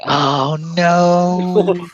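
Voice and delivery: high, rising oh no of dread; dreading being recognized, pitch climbing; mock dread. Telling the fan's recognition, mock dread.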